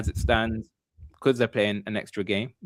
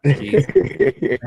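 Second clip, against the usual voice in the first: high loud jeez, higher and louder; shocked; 10 semitones higher, 7 dB louder than usual. Real, not acted. Reacting to a mate's singing, loud and shocked. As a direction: recoil at what you just heard.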